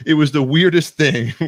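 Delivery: loud, high and cracking up; laughing exclamation. Real, not acted. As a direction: exclaim it through laughter at a crazy memory. Laughing over a wild misadventure.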